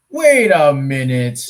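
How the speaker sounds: loud, drawn-out double take; stretched-out, incredulous halt; sudden disbelief. Real, not acted. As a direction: stop everything, slow and loud. Mock alarm at sudden realization.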